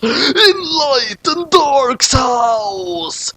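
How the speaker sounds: slow, high, drawn-out crying announcer; dramatic announcement. Acted. Narrator announcing the new scene, slow high theatrical wail. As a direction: stretch it like a melodramatic title card.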